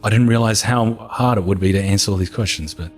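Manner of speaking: ordinary delivery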